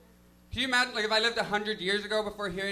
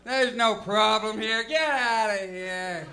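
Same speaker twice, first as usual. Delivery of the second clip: kindly cop; 2 semitones higher, much slower than usual. Retold or imitated. Cop kindly waving him off.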